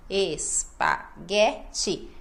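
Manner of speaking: slow espaguete, chopped into es, pa, gue, te; syllable by syllable. Shown as a demonstration. Teacher repeating espaguete to count syllables, syllable by syllable. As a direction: separate all four syllables of espaguete. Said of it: "quatro sílabas também".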